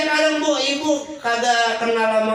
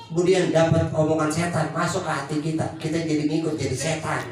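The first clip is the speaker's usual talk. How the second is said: ordinary talk, nothing marked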